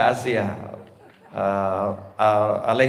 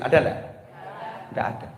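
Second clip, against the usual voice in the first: ordinary talk, nothing marked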